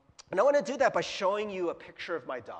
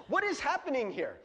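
usual speaking voice

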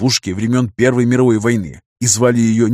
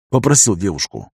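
plain reading voice

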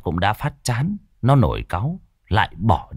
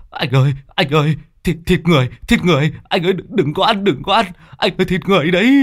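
high, loud, fast panicked cries; terrified pleading; 8 semitones higher, 4 dB louder, much faster than usual. Acted. Mạnh screaming at Tuất to stop eating, in terror. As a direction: beg him in horror not to eat it.

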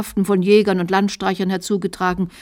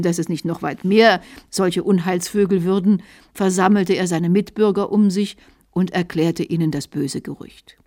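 plain reading voice

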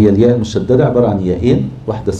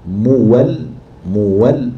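usual speaking voice

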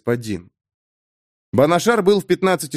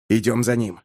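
ordinary delivery, nothing marked

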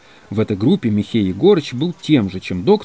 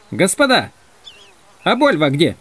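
raised, startled call to everyone; sudden alarm; 7 semitones higher than usual. Acted. Манже noticing Больва is gone, alarmed. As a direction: realise someone is missing and call out.

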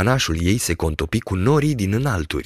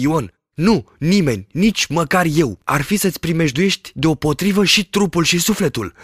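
high, earnest shepherd voice; lightly voiced fearful peasant; superstitious shepherd; 7 semitones higher than usual. A narrator's reading. Shepherd warns about the branch, earnest.

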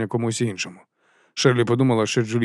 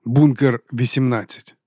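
usual speaking voice

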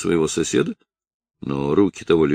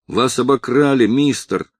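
a touch of tears in a raised, higher shout; crying shout; 6 semitones higher, 4 dB louder than usual. A narrator's reading. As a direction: plead loudly while hurting. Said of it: "закричал Жуан плачущим голосом". (крик сквозь лёгкие слёзы)

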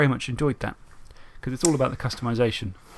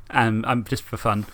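normal talking voice

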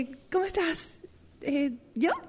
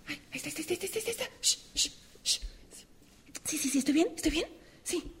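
hushed excitement; 9 dB quieter, much faster than usual. Acted. Hiding, spotting someone arriving.

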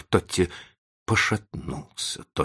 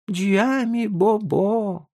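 put-on very high, drawn-out toddler voice; small child's voice; much slower than usual. A narrator's reading. Frightened little boy turns to the professor, in a high child's voice.

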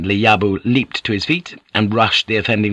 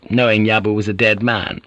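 plain reading voice

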